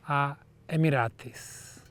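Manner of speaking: ordinary talk, nothing marked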